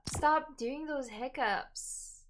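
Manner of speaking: slow pleading. Real, not acted. Pleading with own hiccups.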